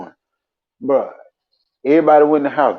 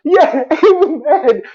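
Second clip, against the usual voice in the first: laughing; 12 semitones higher, 4 dB louder, slower than usual. Real, not acted. Laughing while telling.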